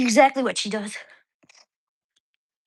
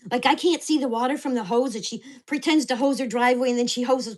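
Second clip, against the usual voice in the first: usual speaking voice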